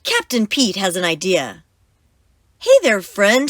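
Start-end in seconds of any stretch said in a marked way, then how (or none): 2.6-3.5 loud, high call across the water; friendly yell. Acted. Captain yelling to the sea monster for help, loud. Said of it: "he yells to the sea monster".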